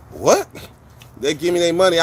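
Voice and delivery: normal talking voice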